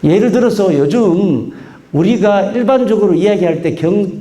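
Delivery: usual speaking voice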